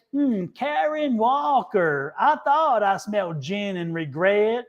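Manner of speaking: slow, drawling sitcom zinger; Leslie Jordan. Acted. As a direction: draw out the catty line in a Southern drawl. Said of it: "He said".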